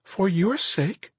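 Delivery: slow, very high, incredulous woman's voice; stunned. Acted. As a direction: drag it out in stunned disbelief. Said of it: "She looked truly stunned".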